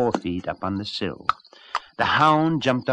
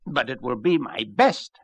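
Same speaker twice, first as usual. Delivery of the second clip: proud; 8 semitones higher, 4 dB louder than usual. Acted. Rooster's proud last crow.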